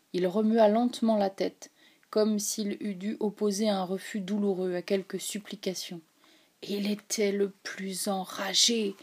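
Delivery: plain reading voice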